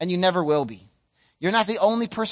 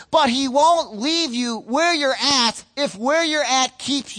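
slow, swelling sermon delivery; fervent pulpit voice; impassioned preaching; 4 semitones higher, 4 dB louder, slower than usual. Acted. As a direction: stretch each phrase out to the congregation. Preaching at full force.

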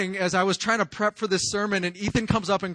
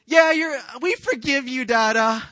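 put-on very high, loud child voice; imitated children's chirp; forgiving kids; 8 semitones higher, 9 dB louder than usual. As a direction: call it out like an eager little kid. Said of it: "when they just go".

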